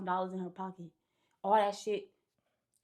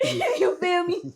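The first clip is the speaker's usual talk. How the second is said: laughing; 8 semitones higher, 9 dB louder, much slower than usual. Real, not acted. Laughing at his story.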